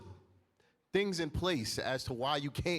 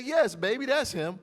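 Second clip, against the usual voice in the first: put-on sweet old lady; 7 semitones higher, 6 dB louder than usual. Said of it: "She said".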